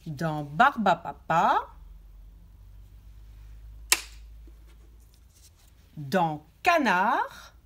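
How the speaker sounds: words drawn out slowly; slow articulation. Shown as a demonstration. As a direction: stretch each word to listen to it. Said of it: "il faut dire le mot et il faut l'écouter". (mots étirés lentement)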